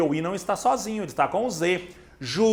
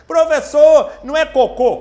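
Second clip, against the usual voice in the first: loud, high student outburst; aluno interrompendo; 8 semitones higher, 8 dB louder than usual. Acted. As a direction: call out high and loud, challenging the teacher as a student. Imita aluno interrompendo o professor. (aluno interrompendo em voz alta e aguda)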